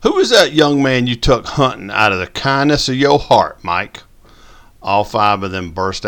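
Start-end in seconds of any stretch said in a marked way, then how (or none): 0.0-4.0 loud, high mocking jab. A narrator's reading. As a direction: needle him with a sly question. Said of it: "take a jab".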